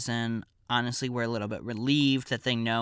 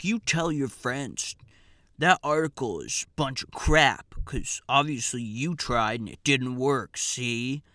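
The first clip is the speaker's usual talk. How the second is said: microaggressing; 2 semitones higher, 5 dB louder than usual. Retold or imitated. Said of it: "obviously microaggressing at us".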